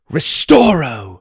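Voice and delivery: slow, loud commanding cast; spell incantation. Acted. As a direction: call out the spell with force. Casting a spell.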